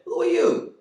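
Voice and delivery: high, loud demand; challenging stranger. Acted. Gilbert challenging the man at the door, loud. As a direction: square up to the stranger.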